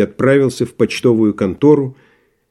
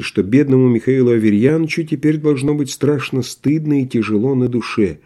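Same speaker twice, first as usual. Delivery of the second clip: plain reading voice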